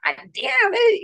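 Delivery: high-pitched exasperated groan into a curse; frustrated outburst. Retold or imitated. Patient re-enacting dropping everything, high frustrated outburst. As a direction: re-enact cursing your own clumsiness, pitched high. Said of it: "I still get frustrated".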